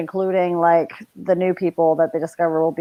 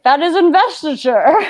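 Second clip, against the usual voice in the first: eager realisation; 9 semitones higher, 5 dB louder than usual. Retold or imitated. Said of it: "it's like".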